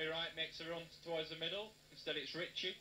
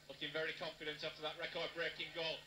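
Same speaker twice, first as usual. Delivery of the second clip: usual speaking voice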